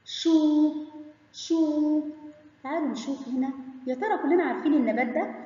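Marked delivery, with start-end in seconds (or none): 0.1-2.0 "شو" higher, stretched as a long shoo. Shown as a demonstration. Teacher introducing waw lengthening, "شو" drawn out long. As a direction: hold the oo in "شو" steady. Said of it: "سامعين صوت المد بالواو؟".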